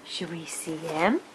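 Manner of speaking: hushed, secret whisper. Real, not acted. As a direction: build suspense in a whisper. Whispered suspense to baby.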